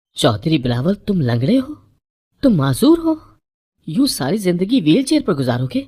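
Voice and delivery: high, loud, disbelieving cry; a stunned bride's shrill accusation; shocked outburst. A narrator's reading. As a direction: hurl the words out in horror. Bride discovers groom in wheelchair.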